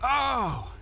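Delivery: high loud gasp of fright; high startled cry. Acted. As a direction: jump at the shape. Startled by figure in dark.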